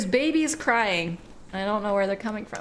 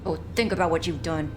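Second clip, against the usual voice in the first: same scold, dropped lower; low-pitched scolding; 4 semitones lower than usual. Pretend. Streamer repeats the telling-off, low-pitched scolding.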